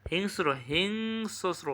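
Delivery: হিংস্র said very slowly and softly, as hing-sro; careful pronunciation. Shown as a demonstration. Teacher warning students about a tricky word, slow হিংস্র. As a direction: dwell on each sound of হিংস্র. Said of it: "এইটা কিন্তু মনে রেখো".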